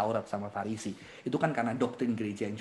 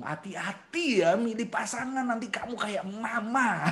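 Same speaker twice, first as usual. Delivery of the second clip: slow, drawn-out higher-pitched caution; warning parent; 8 semitones higher, slower than usual. Acted. Unhappy father warning child about choosing partner, slow mock caution. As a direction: imitate a parent's stretched-out warning.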